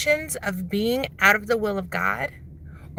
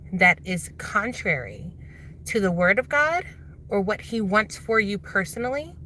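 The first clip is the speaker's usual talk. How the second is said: ordinary talk, nothing marked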